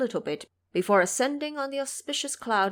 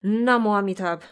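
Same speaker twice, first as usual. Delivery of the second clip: loud grateful cry of the monks; the monks cry out in relief together; 7 dB louder than usual. Acted. Relieved monks cry out to the Buddha, loudly. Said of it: "crying in unison".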